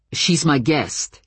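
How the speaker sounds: usual speaking voice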